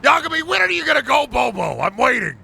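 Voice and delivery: high-pitched mock-impatient yell. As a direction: holler it at a slowpoke. Mock-impatient yelling at game character.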